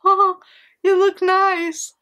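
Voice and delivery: high, slow, strained politeness; mom faking approval. Retold or imitated. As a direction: hide horror behind a sweet tone. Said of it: "she was like".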